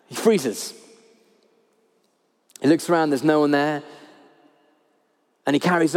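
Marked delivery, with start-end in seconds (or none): none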